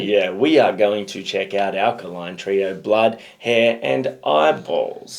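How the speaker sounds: slow, over-careful announcement; deliberately slow. Pretend. Host redoing intro after being too quick, deliberately slow. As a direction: spell it out slowly, word by word. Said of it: "you spoke too quickly for your own good".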